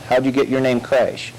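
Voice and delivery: usual speaking voice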